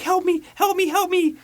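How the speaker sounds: high, frantic cry of help me; mock panicked plea. Hooker pleading down the phone to the detective, frantic and high.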